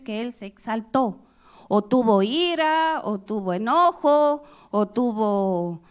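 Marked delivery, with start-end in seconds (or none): none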